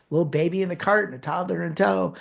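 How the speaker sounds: usual speaking voice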